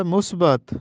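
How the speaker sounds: short u after the m of مثبت; correct pronunciation. Shown as a demonstration. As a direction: say مثبت as "musbat". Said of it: "یہ درست تلفظ ہے". (مثبت کو پیش سے "مُثبَت" پڑھیں)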